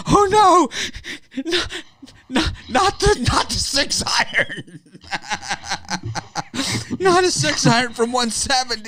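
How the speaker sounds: high, loud, hammed-up panic with laughter; mock terror. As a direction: play a golfer pretending to be terrified of a six iron. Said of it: "It's like".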